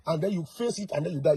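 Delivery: ordinary talk, nothing marked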